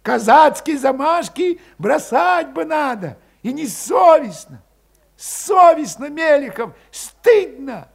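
high, loud reproach; Valet shaming. A narrator's reading. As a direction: shame him hard.

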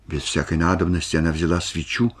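plain reading voice

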